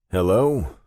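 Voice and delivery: short, loud, impatient hello; terse. A narrator's reading. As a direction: bark it out curtly. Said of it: "I answered tersely".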